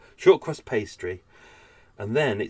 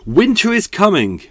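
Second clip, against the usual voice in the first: Winter is coming boomed out high and loud, like a herald; dramatic announcement; 10 semitones higher, 13 dB louder than usual. Shown as a demonstration. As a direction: proclaim it like a grand fantasy warning. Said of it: "I'm gonna announce it now".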